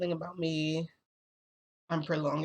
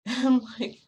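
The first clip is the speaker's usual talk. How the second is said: loud high burst of laughter into the words; laughing; 9 semitones higher, 11 dB louder than usual. Real, not acted. Laughing to viewers at his threat, loud and high.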